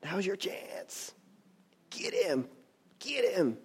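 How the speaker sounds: hushed, slow and secretive, close in the ear; tempting whisper. Acted. Friends urging David in a hushed voice to strike. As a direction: coax him quietly like a tempter. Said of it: "friends that are trying to".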